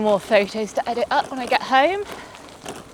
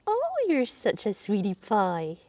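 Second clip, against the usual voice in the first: softer and slower, fond and doting; cooing to a piglet; 7 dB quieter, slower than usual. Real, not acted. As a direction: coo it gently to a tiny piglet.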